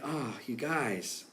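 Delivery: soft, slow, fond sigh of a chide; a caring teacher gently shaking his head; loving chide. Retold or imitated. Jesus to his frightened disciples, a soft, slow loving chide. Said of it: "I think it was a loving, caring".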